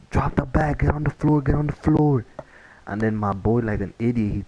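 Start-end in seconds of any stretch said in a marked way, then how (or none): 0.1-2.2 higher-pitched mock police command; imitated police order. Officers ordering kids to drop the bag, imitated urgent command.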